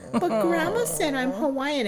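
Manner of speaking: put-on high, pleading kid voice; cling to grandma's word; child protesting. Little girl protesting to mom, high pleading voice. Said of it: "I remember going".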